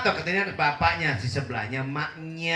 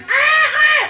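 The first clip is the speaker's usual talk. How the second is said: very high, loud imitation of a baby wailing; mock baby cry; 10 dB louder than usual. Preacher imitating a crying baby to the congregation, high mock wail. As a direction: squeal it like a fussing infant.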